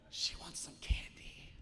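slow, whispered aside; hushed storyteller's aside; stage whisper. Acted. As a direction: whisper it slowly, drawing out the words.

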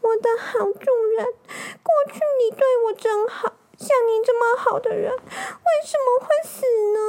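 very high, tearful dog voice; plaintive whimpering lament; grieving puppy. Acted. Stray dog mourns its dead master, high tearful whimper. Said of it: "一面走一面想起去世的老主".

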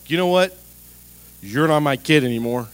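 loud mock outburst at a child; mock angry parent. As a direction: bark it like a fed-up parent.

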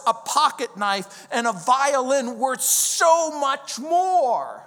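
usual speaking voice

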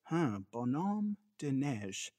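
slow, soft, halting French; broken French. Retold or imitated. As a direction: sound out the French slowly. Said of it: "grade eleven broken French".

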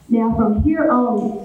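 normal talking voice

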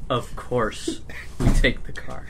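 slowly, drawn out, as if it's obvious; unhurried matter-of-fact drawl; slow deliberate Linda. Acted. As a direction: stretch each word out lazily. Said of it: "She like".

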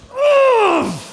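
a sudden high yelp of pain; pained shout. Retold or imitated. Stubbing a toe, yelping out in pain. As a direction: yelp like you just stubbed your toe. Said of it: "and I'd be like".

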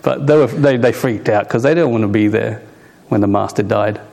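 normal talking voice

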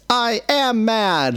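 put-on high, slow, sulky kid voice; angry child. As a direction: stamp it out like a mad seven-year-old. Child's angry reply voiced by dad.

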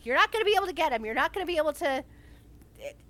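high, loud "no", then rushed protest; imitated pleading shout. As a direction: act out shouting desperately at the screen, fast and high. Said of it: "shout at him and be like".

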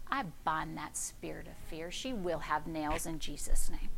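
near-silent prayer under the breath; quiet rebuke prayer. Retold or imitated. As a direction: murmur the rebuke, bent over and sick. Sick, bent over, rebuking fear.

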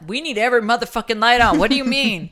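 loud, high, rapid-fire mock outrage; indignant outburst. Arguing with partner who wants lights off, indignant shouting. Acted retort in argument over lights.

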